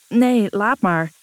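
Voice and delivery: weary, drawn-out groaning refusal. A narrator's reading. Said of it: "kreunt Lucas".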